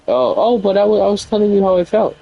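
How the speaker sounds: high, put-on whiny voice; mocking whiny excuse. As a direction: ridicule the excuse in a high whine. Mimicking partner's excuse mockingly.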